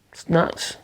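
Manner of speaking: soft breathy whisper, resigned; whispered verdict. Real, not acted. Vlogger dismissing Britain's attitude to viewers, whispered. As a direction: mutter it as a tired aside.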